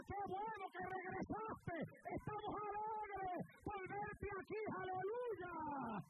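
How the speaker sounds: high, slow, beaming welcome; joyful welcome. Retold or imitated. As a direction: welcome the lost brother home with joy. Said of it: "le diga". (bienvenida alegre, aguda y lenta)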